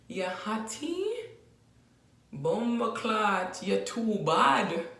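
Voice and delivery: slow, drawn-out Jamaican patois flirt; Jamaican man flirting. Shown as a demonstration. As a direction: Jamaican patois, slow and admiring, ending in a laugh. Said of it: "this is what he's going to say".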